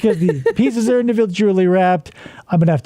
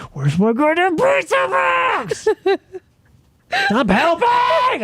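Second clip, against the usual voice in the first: mock yelling; 10 semitones higher, slower than usual. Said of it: "that's why I yell at her".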